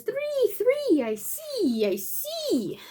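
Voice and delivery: dog bragging to the lion, lightly voiced. The dog teasing the lion with a hint of a sing-song voice. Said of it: "dog says".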